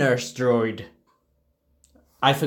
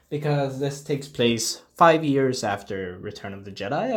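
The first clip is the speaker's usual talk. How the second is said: ordinary talk, nothing marked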